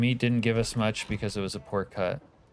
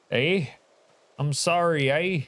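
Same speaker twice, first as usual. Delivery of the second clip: high, loud, hammed-up Canadian "eh"; failed Canadian accent that misses the mark; mock Canadian accent; 6 semitones higher, 3 dB louder, slower than usual. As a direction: play up a bad Canadian accent for laughs. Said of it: "I don't know what accent that was, but it wasn't Canadian".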